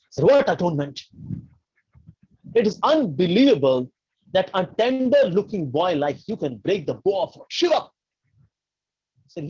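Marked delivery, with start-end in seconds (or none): 0.3-7.9 raised, higher voice with emphatic outrage; acted angry astonishment; indignant disbelief. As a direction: scoff at the offer, incredulous. Acted Parshuram scorns offer of atonement.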